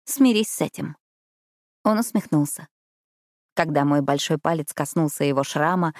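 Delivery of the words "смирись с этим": lightly voiced retort, pitched higher; high-pitched, falling comeback in the heroine's voice. A narrator's reading. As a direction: answer back with a high start that falls. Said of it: "ответила я".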